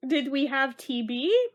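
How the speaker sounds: ordinary delivery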